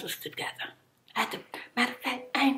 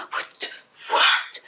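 loud outraged what; 7 dB louder than usual. Real, not acted. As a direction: react in disbelief. Outraged reaction to the plate.

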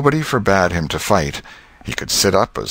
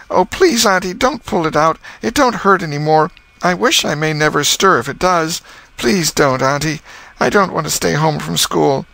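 put-on high, begging boy's voice; pleading boy; 10 semitones higher than usual. Tom begging his aunt not to pull the tooth, high and pleading. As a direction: beg her not to pull it.

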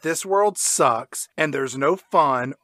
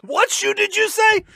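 put-on high panicked kid. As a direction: squeak it in panic. Milo voiced in recap.

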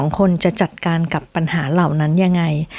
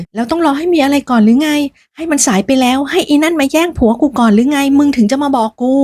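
higher and angrier, voice rising in rage; furious outburst; 7 semitones higher than usual. Acted. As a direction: snap back at him in fury. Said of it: "พูดเสียงสั่นด้วยความโมโหมาก". (ตะคอกด้วยความโมโห)

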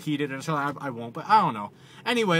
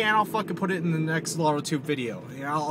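ordinary talk

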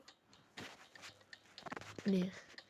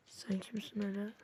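whisper; 10 dB quieter than usual. Real, not acted. Whispered question to enemy.

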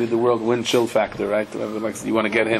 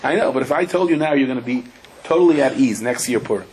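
ordinary delivery

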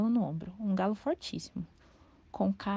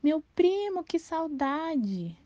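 yelled greeting; 8 semitones higher, much slower than usual. A narrator's reading. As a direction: bellow it to the long-lost cousin. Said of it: "mas Afonso berrou".

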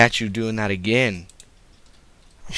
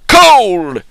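1 shouted name; 9 semitones higher, 14 dB louder than usual. Acted. Parents naming him.

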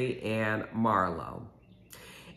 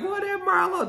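much higher, amazed squeal of disbelief; amazed cry; 3 dB louder than usual. Retold or imitated. The cast squealing in disbelief as Marlo wins the race, amazed. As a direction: squeal it like nobody saw that coming.

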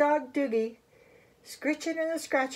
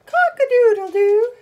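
very loud, high crow; ringing high rooster call; 9 semitones higher, 10 dB louder than usual. Acted. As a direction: crow at full volume like a rooster. Rooster character crows in story.

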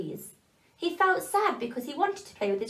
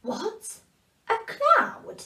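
much higher disbelieving question; surprised; 12 semitones higher, much slower than usual. Acted. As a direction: sound amazed. Elf surprised by the answer.

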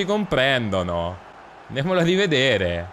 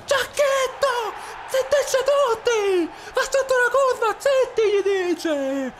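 much higher, squeaky play-by-play; Mickey Mouse voice. Acted. Streamer commentating his game in a squeaky Mickey Mouse voice. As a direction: call the match in a cartoon mouse squeak. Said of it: "voce alla Topolino".

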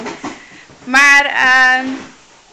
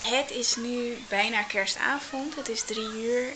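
much softer, calm vlog outro; soft voiceover; 5 semitones lower, 12 dB quieter than usual. Acted. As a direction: talk quietly into the mic, winding down.

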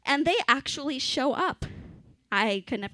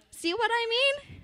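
awkward Hannah voice; 12 semitones higher than usual. Acted. Flesh Hannah voice after shriek.

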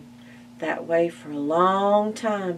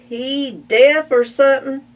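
higher, louder sneering pig; mocking pig; 8 semitones higher, 10 dB louder than usual. Buster the pig scoffing to Spike about Pa, loud.

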